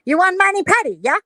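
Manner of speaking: mock Chinese accent. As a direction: imitate a nail tech's Chinese accent. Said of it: "she does a perfect Chinese person".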